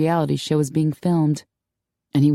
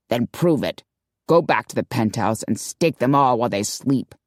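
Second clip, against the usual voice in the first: a slightly lower, hinted Sean, scornful; scathing commander, lightly lowered; lightly voiced gruff boss; 5 semitones lower than usual. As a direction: sneer it as the suspicious commander.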